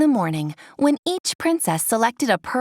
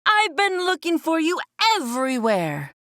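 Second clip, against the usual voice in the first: higher, fretting crab voice; fussy crab; 5 semitones higher than usual. A narrator's reading. Sebastian finding Ariel after searching everywhere, fretting. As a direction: fuss like a worried crab. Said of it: "he cried".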